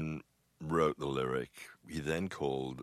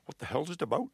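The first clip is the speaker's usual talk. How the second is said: high-pitched quick Welsh accent imitation; 11 semitones higher, faster than usual. Singer hearing the song title first time, mock Welsh accent. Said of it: "in his Welsh accent".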